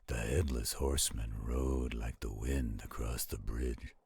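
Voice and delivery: plain reading voice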